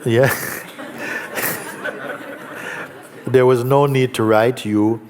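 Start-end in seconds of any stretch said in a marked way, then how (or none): 0.1-3.2 laughing. Real, not acted. Listener laughs at familiar letter.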